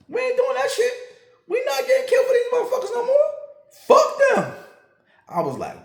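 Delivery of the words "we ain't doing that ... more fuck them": high, loud, laughing refusal; officer declaring they won't risk their lives, loud and high; heated refusal. Retold or imitated. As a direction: throw it out in a loud half-laughing outburst.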